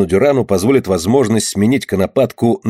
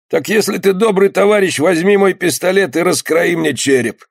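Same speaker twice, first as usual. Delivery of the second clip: high-pitched earnest request; wounded skipper, entreating; 8 semitones higher than usual. A narrator's reading. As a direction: lightly play the dying sailor pleading.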